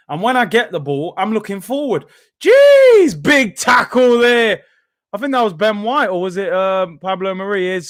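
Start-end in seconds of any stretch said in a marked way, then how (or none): 2.4-4.6 sudden high loud exclamation, then slowed; surprised shout. Real, not acted. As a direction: yell at the crunching tackle. Reacting to a big tackle.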